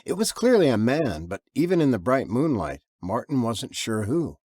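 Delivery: ordinary delivery, nothing marked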